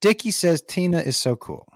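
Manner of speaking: ordinary talk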